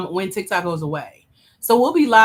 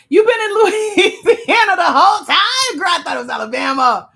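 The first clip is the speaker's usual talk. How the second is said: high, loud, laughing disbelief; laughing surprise; 10 semitones higher, 8 dB louder than usual. Real, not acted. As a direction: squeal it through a laugh. Surprised at viewer's state, laughing.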